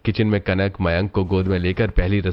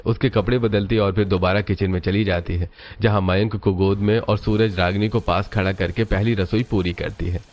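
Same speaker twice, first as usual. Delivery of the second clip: ordinary delivery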